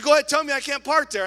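fast, high, loud dare; defiant dare. Retold or imitated. Lady dares the attendant to forbid her. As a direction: taunt him to say it.

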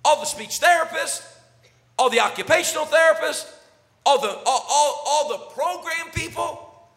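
loud, high, ringing preacher's cry; full-throated revival preaching; preaching at full force. Acted. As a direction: preach it out to the back row. Preacher building to a climax.

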